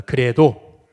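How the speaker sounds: preacher leading a call-and-response; slow call to repeat. Shown as a demonstration. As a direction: declaim it slowly so everyone can repeat it. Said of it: "또 따라해 보겠습니다".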